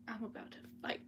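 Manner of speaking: breathless whispered aside to chat; whispering. Real, not acted. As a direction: hush it like a secret threat. Aside to chat while reading.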